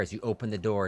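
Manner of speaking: ordinary talk, nothing marked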